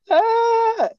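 very high, loud strangled cry; imitated strangled yell. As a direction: mimic a choked, panicked scream. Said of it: "he was making this".

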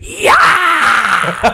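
high loud rock scream. Retold or imitated. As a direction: belt it like a frontman. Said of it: "[name]'s just like".

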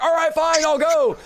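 high, exasperated mock-demon cry; demon giving in. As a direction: yell it like a demon surrendering. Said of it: "The demon's like".

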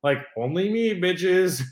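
loud, high pretend bragging; mock cocky boast. Bragging to a friend about out-of-town girls, mock cocky. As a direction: brag it up like a hammy show-off.

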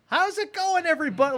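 very high, cheery mock foreigner; hammed-up movie foreigner. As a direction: play the overexcited sidekick. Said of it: "all the foreigners in these fucking movies".

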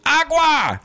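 high, loud shouted "Agua!"; yelled demand. Retold or imitated. Sweaty customer shouting for water at counter, loud yell. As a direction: bellow "Agua!" like a desperate thirsty man. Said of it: "he goes".

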